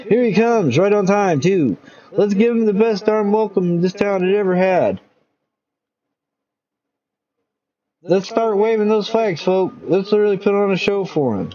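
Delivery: rally the town with a grin in the voice; excited announcer. Acted. Mayor hyping crowd.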